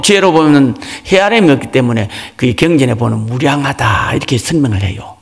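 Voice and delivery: ordinary delivery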